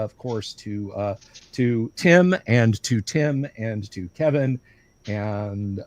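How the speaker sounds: ordinary talk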